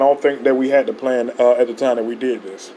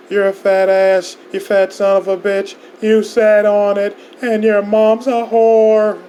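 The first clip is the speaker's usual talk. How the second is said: angry ranting; 6 semitones higher, slower than usual. Acted. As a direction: spit the insults out slowly. Heated insults, louder, slow.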